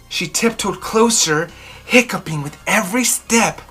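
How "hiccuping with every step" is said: usual speaking voice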